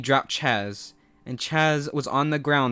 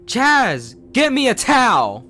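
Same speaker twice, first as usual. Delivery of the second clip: much higher, much louder bellow; angry yell; 10 semitones higher, 10 dB louder than usual. A narrator's reading. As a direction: roar at him from the shower. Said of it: "he yells".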